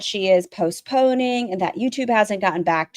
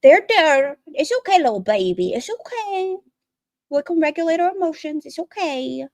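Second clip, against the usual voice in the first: mock soothing parent; 8 semitones higher than usual. Mocking parents soothing upset daughter.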